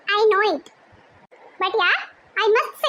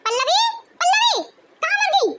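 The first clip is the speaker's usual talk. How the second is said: higher-pitched irritated summons; impatient calling; 8 semitones higher than usual. Acted. Bossy woman summoning Pallavi from another room, impatient calling. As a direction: call out crossly for someone who won't answer.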